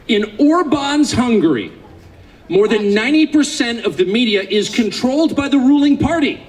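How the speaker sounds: slow, deliberate reading, every word spaced out; read slowly. Shown as a demonstration. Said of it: "I want to read this slowly".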